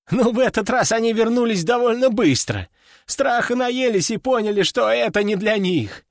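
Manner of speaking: lifted, matter-of-fact officer's voice; earnest subordinate officer. A narrator's reading.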